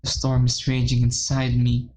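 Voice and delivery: ordinary talk, nothing marked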